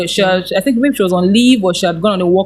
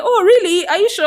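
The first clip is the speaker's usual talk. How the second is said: surprised; 12 semitones higher than usual. Retold or imitated. General counsel surprised at request.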